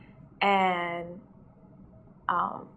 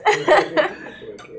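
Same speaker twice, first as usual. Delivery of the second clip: short high laugh, louder; 8 semitones higher, 5 dB louder than usual. Real, not acted. After daring doubters to bring it, a short high laugh. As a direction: laugh right after the dare.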